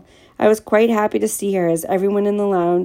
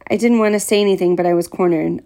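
usual speaking voice

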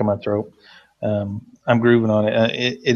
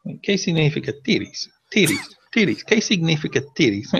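higher-pitched student asking over and over; imitated student; 6 semitones higher than usual. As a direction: mimic an insistent pupil, pitched up. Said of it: "Like seventeen times".

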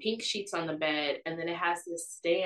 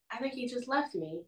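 higher-pitched, mildly panicked thought; retold panic; 7 semitones higher, 4 dB quieter than usual. Alone in the dark room, retold mild panic. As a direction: replay a worried realization. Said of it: "I'm really panicking".

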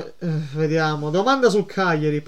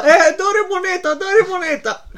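high, slow mock pleading; stingy beggar voice; 11 semitones higher, 4 dB louder, slower than usual. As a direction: whine for money like a miser. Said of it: "quando va a comprare fa".

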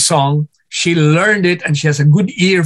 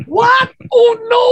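shocked cry. Retold or imitated. Shocked at singer's lost voice.

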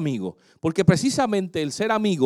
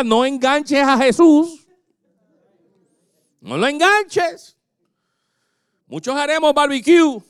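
preaching exhortation; 5 semitones higher than usual. Acted. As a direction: exhort the church to stay faithful. (exhortación de predicador, voz aguda)

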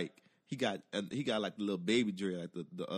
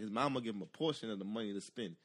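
usual speaking voice

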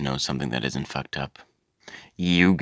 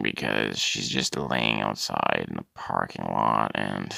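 normal talking voice

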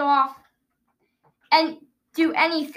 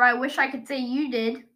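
usual speaking voice

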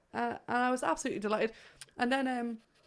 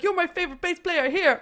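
imitating the guest; 8 semitones higher, 5 dB louder than usual. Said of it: "you were like".

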